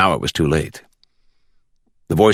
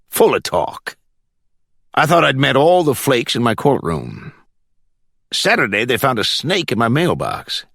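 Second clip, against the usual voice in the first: judge venting about crank calls, pitched up; worked-up judge character, higher than narration; complaining judge; 5 semitones higher than usual. A narrator's reading. Judge grumbling to his visitor about threats, higher voice.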